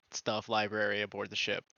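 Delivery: normal talking voice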